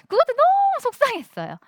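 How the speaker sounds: 높고 크게 터지는 속상한 목소리; 서러움을 터뜨리듯; 속상함. Real, not acted. 답답했던 시절 한탄. (속상해서 높아진 목소리)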